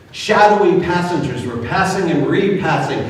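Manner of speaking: plain reading voice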